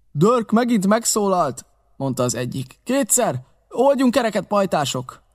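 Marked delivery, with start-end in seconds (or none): 0.1-1.6 high, slow warning; raised, drawn-out voice; alarmed call. A narrator's reading. 2.8-5.2 high call, dropping at the end; alarmed raised voice; urgent warning. A narrator's reading. Pirate urges flight.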